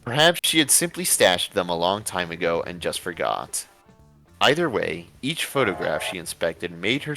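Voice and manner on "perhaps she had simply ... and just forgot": ordinary talk, nothing marked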